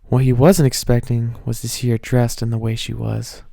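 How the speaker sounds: ordinary delivery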